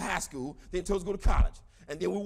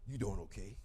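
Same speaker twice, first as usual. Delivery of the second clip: low, soft, deadpan question; deadpan low mock voice of Dave; Dave's low reply; 13 semitones lower, 9 dB quieter than usual. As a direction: drop low and quiet as the tall athlete.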